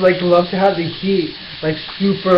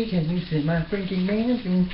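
normal talking voice

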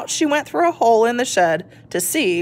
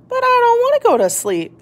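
put-on much higher, a small child's protest; little tortoise voice; 14 semitones higher than usual. Shelley tells her parents she is not sleepy, in a high child voice. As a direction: squeak it out like a wide-awake little one.

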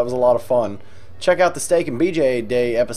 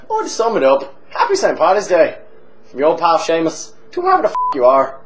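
boozy Irish pub regular, chatty and cheeky; hammed-up Irish drunk telling a tale; stage Irish accent. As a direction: lay on a thick comic Irish brogue. Held Irish voice.

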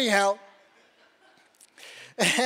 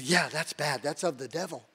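mock disapproval; 6 semitones lower, 7 dB quieter than usual. Mocking his church's warning about tongues, deadpan sarcasm.

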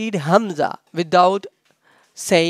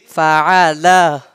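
Fa'ala joined up, firmly and louder; said together; 5 dB louder than usual. Shown as a demonstration. Teacher repeating the joined word for students, said together. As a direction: run Fa'ala together as one strong word. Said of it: "We have to say them together".

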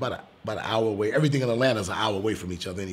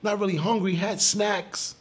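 lightly voiced polite kid, higher; playing the six-year-old, higher and polite; a young boy; 10 semitones higher, slower than usual. Father voicing his young son declining food, a polite kid. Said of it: "Hunter goes".

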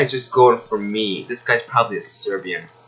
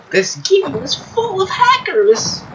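slow, very high put-on voice; high-pitched mock complaint; 3 dB louder, slower than usual. Gamer complaining about hackers, high drawn-out mock outrage. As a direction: draw it out in a squeaky high voice.

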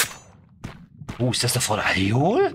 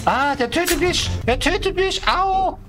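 much higher, hammed-up dying wail; mock death cry. Shark eats the crab, hammed-up high death cry. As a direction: wail like you're being eaten.

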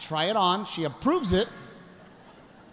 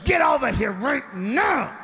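stern order; 6 semitones higher, slower than usual. Retold or imitated. Wife orders husband.

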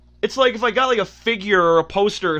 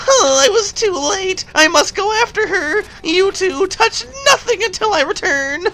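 game character voice; 12 semitones higher than usual. Acted. Character rushing after the Enchantress, warning the pair, urgent. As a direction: put on a high cartoon voice with grand urgency. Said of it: "now I'm gonna do the voices".

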